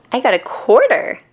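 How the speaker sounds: high, bright and pleased; pleased to show it off; happy. A narrator's reading. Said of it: "says Violet happily".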